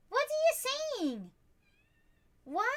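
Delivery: high, slow pet voice. Real, not acted. Asking the kitten what it says, high baby talk. Talking to the cat.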